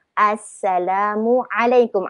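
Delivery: Assalamualaikum very slow, each syllable separate. Shown as a demonstration. Teacher dictating the spelling to learners, Assalamualaikum slowly broken up. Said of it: "dalam tulisan bahasa Melayu dia macam inilah".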